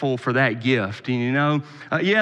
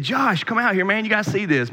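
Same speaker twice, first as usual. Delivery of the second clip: higher, excited call to his son; dad calling out; 7 semitones higher than usual. Retold or imitated. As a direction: call the boy out, thrilled to show him.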